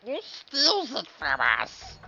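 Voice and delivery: high, slow whiny complaint; drawn-out mock whine. Mock whining about stolen kill.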